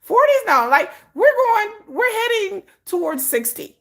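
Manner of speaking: high, loud, incredulous; excited. Retold or imitated. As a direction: exclaim it in disbelief. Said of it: "I'm like".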